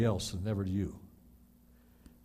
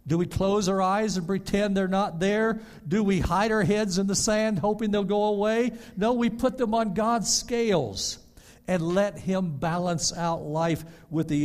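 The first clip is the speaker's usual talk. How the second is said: preaching; 6 semitones higher, 4 dB louder than usual. Acted. Rhetorical questions to the congregation.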